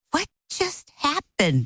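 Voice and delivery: slow, high-pitched disbelief; stretched, stunned question; shocked. Retold or imitated. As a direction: act out stunned bewilderment. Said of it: "I am shocked out of my mind".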